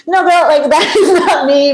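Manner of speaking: laughing objection. Retold or imitated. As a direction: wave the idea off through a laugh. Acts out her laughing objection.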